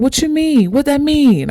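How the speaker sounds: high-pitched, mildly panicked questions; retold startled confusion; alarmed questioning. Acts out her scared questioning.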